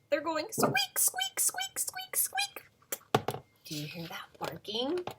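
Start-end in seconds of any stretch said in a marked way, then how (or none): 0.1-2.6 high, whispered squeaks. Acted. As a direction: squeak like wet shoes. Imitating squeaky shoes.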